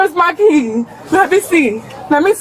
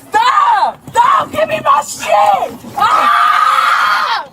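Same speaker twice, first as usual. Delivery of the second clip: pained high yells while held; arm twisted back; 9 semitones higher, much slower than usual. Real, not acted. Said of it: "He's putting my arm".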